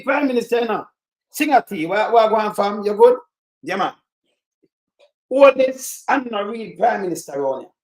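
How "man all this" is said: ordinary talk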